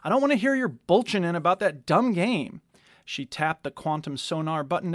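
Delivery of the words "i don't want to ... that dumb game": raised, fed-up voice; annoyed scolding. A narrator's reading. Kopesh scolding Dactyl about his game, fed up. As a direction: shut him down, irritated.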